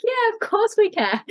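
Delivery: put-on bright high yes to the child; high playful voice. Mother agreeing to play the panda game, high playful voice. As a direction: answer in the game's high silly voice. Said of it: "I'm like".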